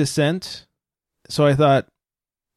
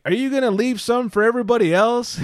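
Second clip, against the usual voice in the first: sarcastic jab; 8 semitones higher than usual. Retold or imitated. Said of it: "I was like".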